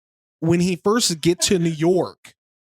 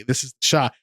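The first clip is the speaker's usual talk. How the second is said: ordinary delivery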